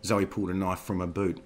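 ordinary delivery

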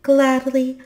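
high, loud, delighted acceptance; happy. A narrator's reading. Tulipa accepts the boy's invitation, happy and eager. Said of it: "looking happy".

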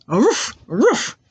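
two imitated dog barks; short teacher's doggy woofs. Shown as a demonstration. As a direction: bark twice like a dog. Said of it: "they say".

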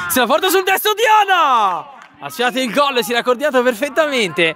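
high excited goal call; breathless italian goal commentary. Real, not acted. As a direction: call the strike with rising thrill.

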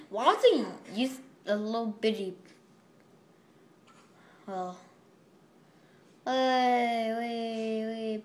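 ordinary delivery

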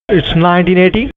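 ordinary talk

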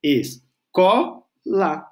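slow, chopped "Escola" peaking on CO; high tonic syllable. Shown as a demonstration. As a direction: break up "Escola", punching the middle CO. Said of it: "ela é a sílaba mais alta".

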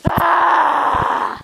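hammed-up wail of triumph; over-dramatic cry. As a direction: wail it like a melodrama. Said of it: "A little over dramatic".

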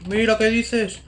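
very high squealed exclamations, repeated; high-pitched freak-out. Acted. Gamer to whoever is in there, get out, high-pitched squealing. Startled frantic shrieking at game.

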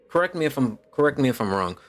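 ordinary talk, nothing marked

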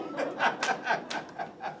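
soft happy laughter fading out; breathy laugh. Acted. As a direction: laugh it off with relief. Joyful laughter after the news.

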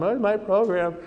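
hammed-up whine over a stolen program; student whining at the consultant's desk; mock wail. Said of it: "I'm yelling at him".

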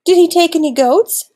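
high, loud alarmed question; gasping. A narrator's reading. Worried villager asking after the goats, gasping. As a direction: ask it with a gasp of alarm. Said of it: "another gasped".

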